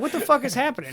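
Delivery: high, startled disbelief; shocked. Retold or imitated. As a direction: blurt it out in shock. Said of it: "I'm like".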